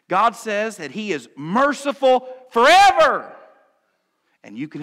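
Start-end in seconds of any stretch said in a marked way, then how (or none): 0.1-3.3 preaching shout. Real, not acted. Preacher to his congregation, a big loud proclamation. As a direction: voice thrown high and loud on merciful forever.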